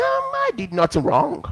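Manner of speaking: high mock voice of a smug wrongdoer; smug denial. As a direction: mimic the arrogant one playing innocent. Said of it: "They'll say".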